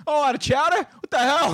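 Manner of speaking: high, outraged, hammed-up shout of disbelief; indignant stranger. Retelling the stadium fan who finds no chowder, high mock outrage. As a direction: play the fed-up fan, pitch jumping up. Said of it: "this guy pops his head out of the corner and goes".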